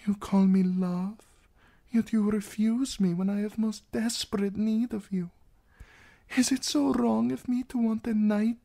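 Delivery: high, fragile princess voice; vulnerable. A narrator's reading. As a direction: plead for protection in a lifted voice. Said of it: "He had never heard her sound so vulnerable".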